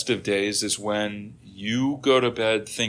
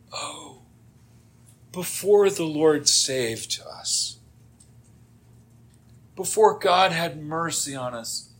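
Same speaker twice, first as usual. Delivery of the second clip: drawn-out, hammed-up complaint; mock grumbling; 3 semitones higher, slower than usual. Israelites pining for onions and leeks, mock grumbling. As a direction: moan wistfully, lightly mocking the grumblers. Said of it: "They start grumbling about".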